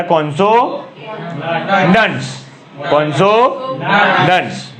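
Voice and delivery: "કોન્સોનન્ટ્સ" stretched very slowly, every sound drawn out; drawn-out classroom pronunciation; slow teaching demo. Shown as a demonstration. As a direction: spell it out slowly for students. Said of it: "બે ભાગ કરી દેવાના".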